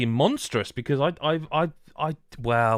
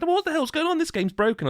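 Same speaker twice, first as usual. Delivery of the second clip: baffled outcry. Retold or imitated. Retold friend baffled in game.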